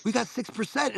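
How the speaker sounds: high sing-song mock cheer; mock cheerful. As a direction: fake upbeat, pretending all's fine. Mocking own phone habit.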